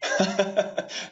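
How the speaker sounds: short amused laugh; a quick warm laugh. Real, not acted. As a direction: laugh at the joke. Host laughs at regular joke.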